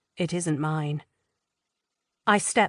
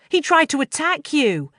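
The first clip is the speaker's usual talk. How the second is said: outraged; 9 semitones higher, 5 dB louder than usual. A narrator's reading. Roz outraged.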